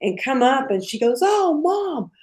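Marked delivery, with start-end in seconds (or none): 1.2-2.1 high, loud panicked daughter voice; mimicking daughter. Daughter seeing mom's bloody face, high alarmed cry. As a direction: squeal it like a startled teen.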